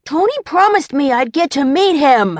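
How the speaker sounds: put-on a small kid begging to see the fight; eager little boy. A narrator's reading. Young boy pleads.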